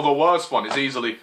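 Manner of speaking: ordinary talk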